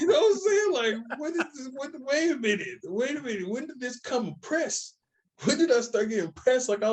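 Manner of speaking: high, disbelieving what-is-happening; incredulous. Real, not acted. Incredulous reaction to being pressed.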